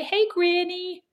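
put-on high, drawn-out American kid's call; mocking sing-out in an American accent. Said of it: "'cause they have an American accent".

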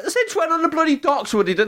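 high, laughing indignant granddad; indignant old man. Retold or imitated. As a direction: squeal it out like an outraged granddad. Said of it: "he was like".

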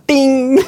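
very high, loud ding; sudden ringing comic impact; sting sound effect. Retold or imitated. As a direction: imitate a direct hit on the nose. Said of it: "rätt på så här".

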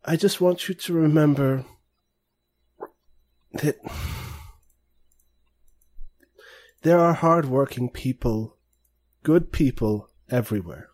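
a touch of sadness; understated sorrow from a kindly grandpa; soft, sad. Acted. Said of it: "softly and sort of sadly says".

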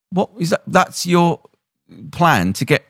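high-pitched disbelieving question; incredulous, pitch jumping up; mock incredulity. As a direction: act out a baffled viewer. Viewer reacting to botched police plan.

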